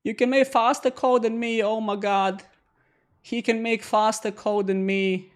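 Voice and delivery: mock the viewer's boast; mock awe.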